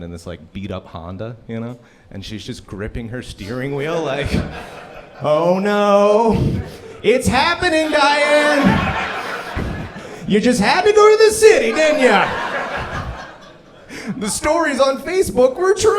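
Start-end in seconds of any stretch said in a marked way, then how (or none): 5.2-15.9 high, loud mock-panicked woman; shrieky imitation of a terrified driver; hammed-up panic. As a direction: wail it like a driver losing it. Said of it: "she just loses it".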